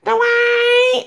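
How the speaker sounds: high, loud call of the name; squealing fan shout; excited shout. Retold or imitated. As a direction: belt the name out in delight. Shouting character's name in fandom.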